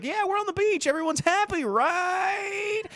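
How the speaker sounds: very high, sing-song fake cheer; mock happy beachgoers. Retelling the Jaws beach scene, mock high cheer. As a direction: squeak it out like carefree townsfolk. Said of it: "And they're like".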